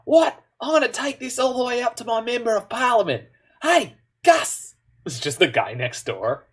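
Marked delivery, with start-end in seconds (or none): 0.1-4.8 high-pitched comic Australian outrage; hammed-up indignant Australian, calling out to a neighbour; mock-outraged Australian accent, pitched way up. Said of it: "shares that with Australia where it's like".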